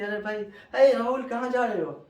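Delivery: calling out. Retold or imitated. Calling out to a friend.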